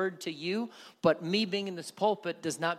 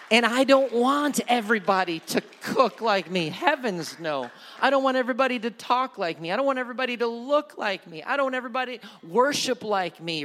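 higher, louder preacher cadence; fervent preaching; 6 semitones higher, 8 dB louder than usual. Acted. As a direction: build the passion line by line. Passionate sermon build.

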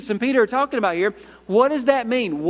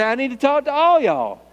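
extra punch on ALL Y'ALL; punchy emphasis; 3 semitones higher than usual. Shown as a demonstration. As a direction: build to a firm, falling ALL Y'ALL. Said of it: "a little bit more punch".

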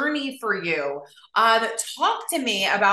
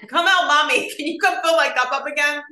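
calling to daughter; 6 semitones higher, 4 dB louder than usual. Retold or imitated. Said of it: "I'm like".

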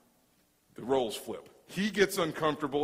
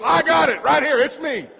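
loud, high eager call; bursting to be picked, high and loud; eager shout; 12 semitones higher, 14 dB louder than usual. Retold or imitated. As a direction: shout it out like you can't wait. Eager congregant calling out.

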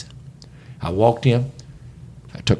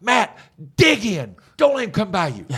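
coaching yell; 8 semitones higher than usual. Retold or imitated. Coach yelling at defender in drill.

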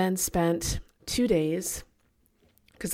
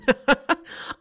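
a short high laugh at her own story; laughing at herself; 8 semitones higher, 4 dB louder than usual. Real, not acted. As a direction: burst out laughing at yourself.

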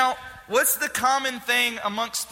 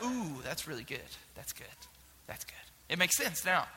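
soft, impressed murmur; 6 semitones lower, 12 dB quieter than usual. Retold or imitated. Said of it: "I was like".

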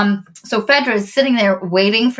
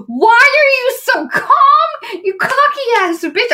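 exasperated yell; 4 dB louder, slower than usual. Real, not acted. Fan venting at smug villain.

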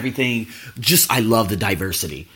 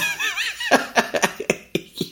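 high laugh; 4 dB quieter than usual. Real, not acted. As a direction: burst of high-pitched laughter. Laughs at own annoyance.

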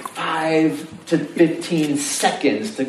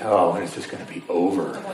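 bored sigh; 8 semitones lower, 4 dB quieter than usual. Retold or imitated. Bored student's inner voice.

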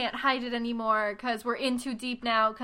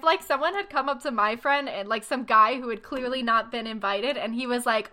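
normal talking voice

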